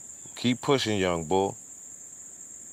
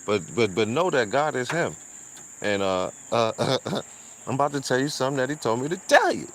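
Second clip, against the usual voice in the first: quoting the pastor; 7 semitones higher than usual. Retold or imitated. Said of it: "He just said".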